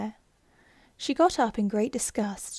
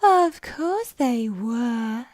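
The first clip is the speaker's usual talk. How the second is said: sleepy Dormouse; 5 dB louder, much slower than usual. Acted. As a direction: drawl it out like a half-asleep mouse. Dormouse answers Alice.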